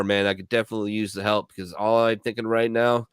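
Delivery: ordinary delivery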